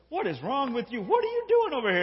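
high-pitched, indignant questioning; indignant retort. Acted. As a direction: demand to know what his problem is. Candy snaps back at shouting driver.

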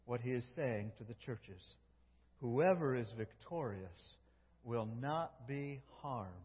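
soft, low, intimate preaching; soft closing sermon. Acted. Preacher closing the message to the church, soft and intimate. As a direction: bring them in close, quietly.

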